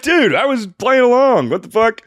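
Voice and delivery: mock outrage at a man who missed the joke; exasperated. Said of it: "I was like".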